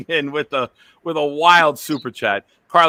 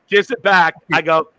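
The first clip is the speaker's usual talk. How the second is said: ordinary talk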